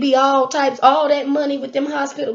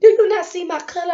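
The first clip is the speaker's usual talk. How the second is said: faster sassy retort; 7 semitones higher, faster than usual. Retold or imitated. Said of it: "'Cause I said".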